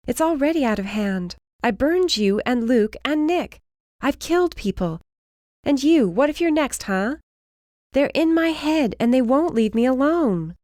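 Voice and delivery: desperate. Acted. She breaks down in panic.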